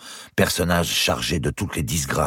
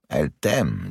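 measured echo; slower than usual. Acted. Uncle echoing his nephew's claim, measured and doubtful. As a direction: hand the claim back, weighing it.

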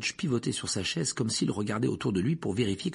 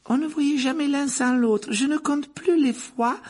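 barmaid voice; 14 semitones higher, 4 dB louder than usual. A narrator's reading. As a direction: lift the voice high and serve the drinks sweetly. Rosmerta fondly remembers the boys.

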